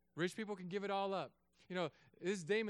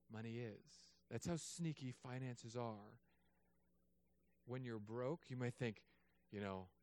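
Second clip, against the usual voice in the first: usual speaking voice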